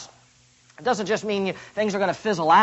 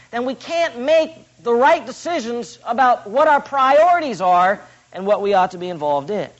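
ordinary talk

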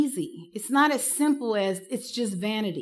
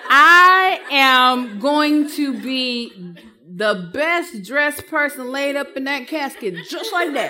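loud, laughing through the words; laughing loudly; 4 semitones higher, 6 dB louder than usual. Real, not acted. She jokes to the counselors, laughing loudly. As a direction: laugh it out, having fun. Joking about her shoes and casket.

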